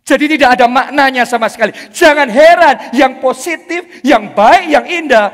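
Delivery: loud, driving sermon lines; full-force preaching. Acted. Preacher warning the church, loud and driving. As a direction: hammer each word home.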